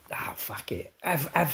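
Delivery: whispered aside, voiceless; mock whisper. Pretend sick call to the boss, whispered aside. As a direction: hush it like a secret aside mid phone call.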